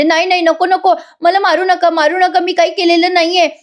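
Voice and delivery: high-pitched pleading without real shouting; retold panicked cry. Suman begs Nagraj not to hit her, mock panicked cry. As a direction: imitate a frantic plea, pitch held high. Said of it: "मोठ्याने ओरडते सुमन आणि म्हणते".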